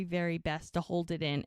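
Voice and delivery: normal talking voice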